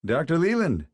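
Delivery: a touch of unintended mockery; mocking blurt. A narrator's reading. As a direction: blurt the name with a swoop up and down. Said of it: "blurted out in a voice that came off much more mocking".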